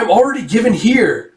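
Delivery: high-pitched objection; indignant protest. Retold or imitated. Sister objects to being given as bride, indignant.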